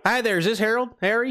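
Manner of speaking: high-pitched Southern prank caller; Southern accent. Pretend. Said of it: "we're doing the Southern accent".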